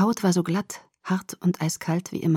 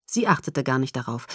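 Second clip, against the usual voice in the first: plain reading voice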